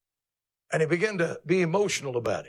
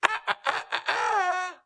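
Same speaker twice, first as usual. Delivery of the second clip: high shouted crowing cry; a rooster's strained, high crow. Retold or imitated. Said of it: "The rooster said".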